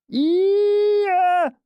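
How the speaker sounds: put-on the boy insisting, calling out hard; wordless cry. A narrator's reading. Tied boy cries out again.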